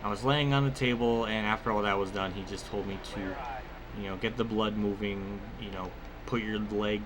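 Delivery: ordinary talk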